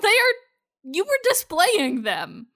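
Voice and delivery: high, slow sassy comeback; mock exasperated girl, come on; sassy retort. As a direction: drag it out, talking back to her. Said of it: "And I'm like".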